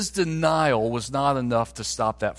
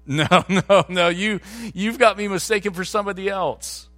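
loud, hurried "no, no, no" brushing off the accuser; a man caught out, denying it fast; flustered denial; 6 semitones higher, 3 dB louder than usual. Retold or imitated. Peter denies being a disciple.

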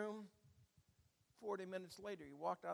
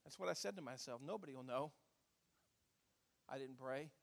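ordinary talk, nothing marked

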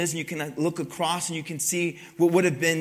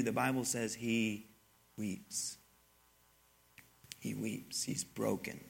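hushed grief; 6 semitones lower, 11 dB quieter, slower than usual. Real, not acted. Tells Jesus weeping.

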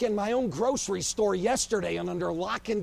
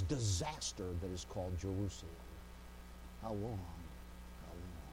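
fading cry; 13 semitones lower, 14 dB quieter than usual. Acted. After the screaming.

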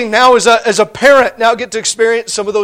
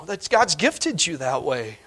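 usual speaking voice

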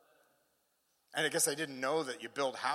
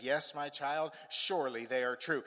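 acted reassurance; 4 dB louder than usual. The Father answering his troubled child, calm reassurance. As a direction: answer the plea with calm certainty. God's reassurance after pleading questions.

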